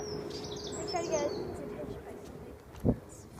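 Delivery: breathy hushed whisper. Real, not acted. To Mom, a hushed whisper near the cat. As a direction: hush it so the cat stays. Repeats it hushed after "stop.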